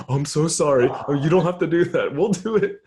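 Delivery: imitating an embarrassed apology; mock frantic apology. Retold apology to the maid.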